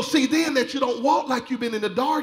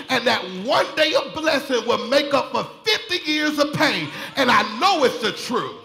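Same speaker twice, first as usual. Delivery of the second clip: usual speaking voice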